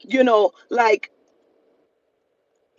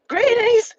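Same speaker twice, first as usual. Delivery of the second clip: mock greeting; 12 semitones higher than usual. Groggy friend answers FaceTime.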